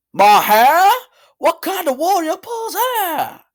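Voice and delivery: put-on high, loud Australian accent; drawn-out, indignant Australian squawk; Doc Shake. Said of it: "she sounds like an Australian to me".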